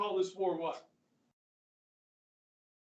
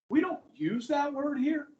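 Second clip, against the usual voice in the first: stern correction; 6 semitones higher, 4 dB louder than usual. Retold or imitated. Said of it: "She's like".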